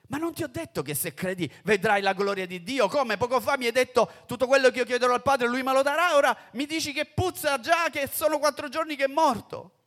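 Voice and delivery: più alto e più forte, veloce; rimprovero acceso. Acted. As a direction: incalza Marta con fervore. Gesù rimprovera Marta. (Gesù rimprovera Marta, voce alta e incalzante)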